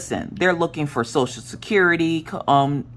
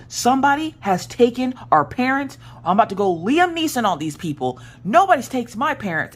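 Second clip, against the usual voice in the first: high, emphatic indignant declaration; pitched-up, dramatic outrage; retold outraged vow; 10 semitones higher than usual. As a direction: re-enact a worked-up rescue threat. Vowing to rescue kidnapped parents.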